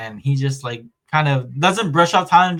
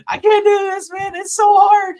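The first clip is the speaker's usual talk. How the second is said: very high, loud mock wail; wailing Zenitsu; 6 dB louder than usual. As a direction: shriek it like a comic crybaby. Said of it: "he's so fucking funny in this episode".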